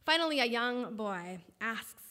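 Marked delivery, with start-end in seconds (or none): none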